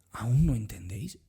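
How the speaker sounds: hushed, breathy rebuke; a soft whispered reproach. Acted. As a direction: whisper the question as if leaning in close. Quoting Jesus in a whisper.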